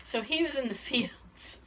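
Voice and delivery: ordinary talk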